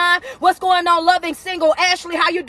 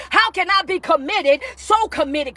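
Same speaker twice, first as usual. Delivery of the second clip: normal talking voice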